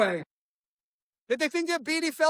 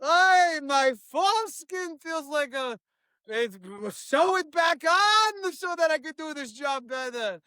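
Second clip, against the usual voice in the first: hammed-up Israeli; 3 semitones higher, slower than usual. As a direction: stretch it out like a ranting Israeli agent. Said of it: "Closes the door".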